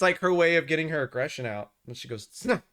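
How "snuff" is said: short, higher-pitched huffy snort; imitated dog snort. Owner imitating his dog when mad, snorting. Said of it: "she snorts, she, like, s-, breathes out of her nose".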